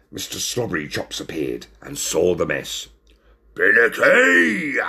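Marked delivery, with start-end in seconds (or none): 3.5-4.8 loud, drawn-out growled call; angry growl. A narrator's reading. Cafe boss confronts his waiter, growling loudly. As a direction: roar the name out slowly, high and growling. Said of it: "he growled".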